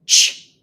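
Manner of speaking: teacher isolating the "Ch" sound; isolated ch sound. Shown as a demonstration. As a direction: push out the "Ch" sound on its own. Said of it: "What do you hear?".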